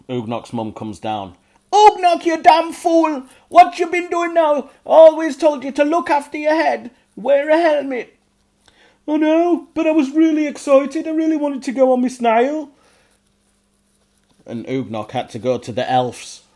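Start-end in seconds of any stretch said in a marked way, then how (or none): 1.7-3.2 very high, much louder furious scolding shout; angry goblin mother. A narrator's reading. Mum shouting at hurt Ugnok, loud angry scold. 3.5-8.2 very high, much louder furious scolding shout; angry goblin mother. A narrator's reading. Mum shouting at hurt Ugnok, loud angry scold. 9.1-12.7 high, loud goblin voice pleading; goblin excuse. A narrator's reading. Ugnok answering his angry mum, high loud.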